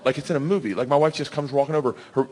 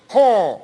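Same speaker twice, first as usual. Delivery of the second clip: high, loud open-mouthed huh; imitated loud beckoning noise; wife's beckoning huh; 7 semitones higher, 5 dB louder than usual. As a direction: mimic a bold come-and-get-it call. Wife beckons bear with marshmallow.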